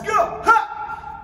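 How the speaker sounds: high, loud karate yell; kiai shout. Shown as a demonstration. Karate teacher shouting the kick call for students, loud.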